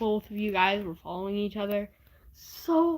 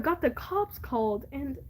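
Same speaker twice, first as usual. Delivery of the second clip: usual speaking voice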